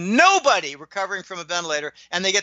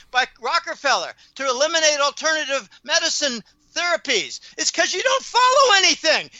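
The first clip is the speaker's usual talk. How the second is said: raised, higher, heated telling-off; heated retold rant; 8 semitones higher than usual. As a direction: hammer them with every reason, voice up high. Retold angry rant at friend.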